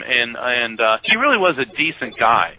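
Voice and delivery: usual speaking voice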